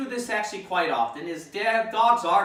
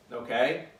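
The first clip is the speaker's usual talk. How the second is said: usual speaking voice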